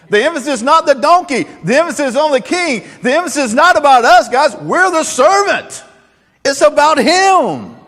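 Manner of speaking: high, loud, ringing proclamation; shouted sermon climax; preaching at full force. Acted. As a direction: rouse the congregation.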